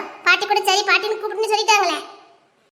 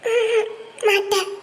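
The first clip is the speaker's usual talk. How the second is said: shy refusal; 3 semitones higher, much slower than usual. Real, not acted.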